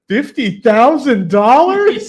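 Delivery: high, loud, slow quizzical guess; mock confident wrong answer. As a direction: ham up the clueless guess. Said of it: "I think he says".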